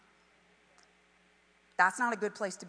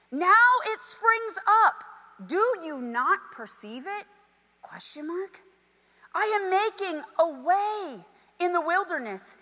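scripture reading; 5 semitones higher than usual. Acted. Reads scripture to congregation.

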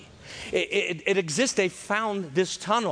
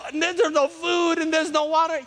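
high whine; 10 semitones higher, 3 dB louder than usual. Retold or imitated. Preacher mimicking whining crowd.

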